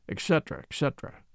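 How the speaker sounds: soft, trailing mumble; muttering. A narrator's reading. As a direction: let it fade away under your breath. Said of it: "muttering something about it".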